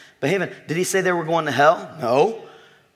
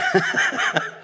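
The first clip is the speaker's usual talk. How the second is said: very high breathless laugh; a helpless high squeak of laughter; wheezing squeal of laughter; squeaky silent laugh. Real, not acted.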